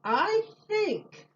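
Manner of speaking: put-on a high little boy. A narrator's reading. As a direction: pipe up like a small boy. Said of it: "said a little boy".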